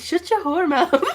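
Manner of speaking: laughing mock insult. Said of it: "So I almost said to you guys".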